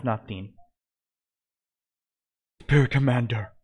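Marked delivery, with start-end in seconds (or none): 2.6-3.5 mildly startled gasp on the words; a touch of shock, pushed out; gasped exclamation. A narrator's reading. Stabbed Hades sees his commander, understated gasp. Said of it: "Hades gasped".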